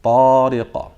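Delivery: loud, sustained Tariqa ending in a short echoing "qa" bounce; qalqala pop. Shown as a demonstration. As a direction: chant Tariqa on a level note, long "taa", popped qaf at the end. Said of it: "So let's try it again".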